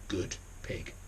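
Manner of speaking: plain reading voice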